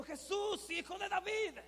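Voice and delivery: high, restrained calling-out; retold cry. Preacher quoting the cry that caught Jesus' attention, retold high call. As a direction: lift the pitch without shouting. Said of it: "gritó con todo su corazón".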